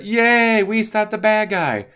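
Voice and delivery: high, loud mock cheer of victory. Squad celebrating its win, high mock cheer. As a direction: cheer it like a cartoon winner.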